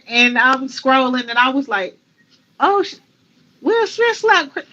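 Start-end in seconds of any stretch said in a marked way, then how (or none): 2.6-4.6 stunned. Retold or imitated. Reacting to the news scrolling.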